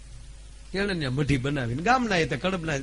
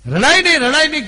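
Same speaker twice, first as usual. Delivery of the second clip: put-on higher and louder, pleading the child to stop crying; mother's insistent call; 10 semitones higher, 11 dB louder than usual. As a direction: call out રડાય નહીં loud and high, pressing the child. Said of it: "કીધું બે વાર". (દીકરીને ઊંચા અને મોટા અવાજે સમજાવતી માતા)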